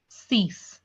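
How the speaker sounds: "six" pronounced "sees", with no k; long ee and final s on "six"; correct French six. Shown as a demonstration. Teacher repeats French six, French pronunciation. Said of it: "Not six".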